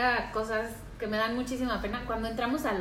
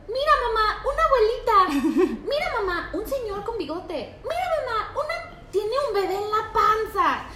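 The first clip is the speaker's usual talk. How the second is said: put-on excited toddler; 10 semitones higher, 5 dB louder than usual. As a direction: point and announce each stranger gleefully. Toddler pointing at people.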